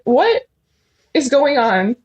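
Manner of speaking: high, loud disbelieving "What"; shocked, indignant exclamation; incredulous outrage. Retold or imitated. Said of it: "and I'm like".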